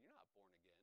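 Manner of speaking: very low, soft inner voice; hushed conviction. Retold or imitated. Holy Spirit convicting him as he reads, hushed and low. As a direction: say it quietly, like a private verdict.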